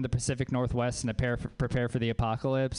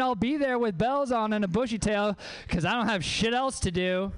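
high, gushing mock enthusiasm; hammed-up desperate eagerness, pitched high; thirsty, over-eager; 9 semitones higher than usual. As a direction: gush like you have nothing better to do. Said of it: "sounding like a thirsty bitch".

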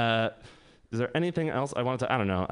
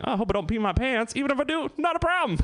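giddy high on Molly; 11 semitones higher, faster than usual. Retold or imitated. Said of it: "I was just like".

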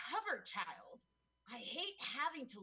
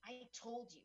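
ordinary talk

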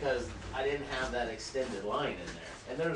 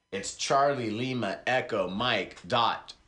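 normal talking voice

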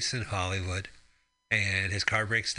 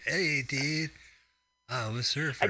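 lightly voiced laid-back surfer; a hint of a lazy surfer drawl; surfer dude voice; 5 semitones higher, slower than usual. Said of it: "It's just like".

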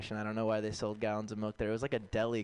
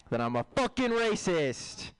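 high, drawn-out punchline; mock-proud outburst; defiant declaration; 6 semitones higher than usual. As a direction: proclaim it slowly, pitched up.

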